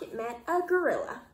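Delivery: usual speaking voice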